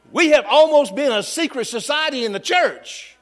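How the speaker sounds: rising preacher's cadence, pressing the point; preaching. Acted. Pastor urging his church to go public, in full preaching voice.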